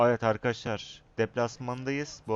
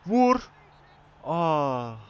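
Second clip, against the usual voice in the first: sharp excited yell; 6 semitones higher, 7 dB louder than usual. Real, not acted. Urging a shot in the game.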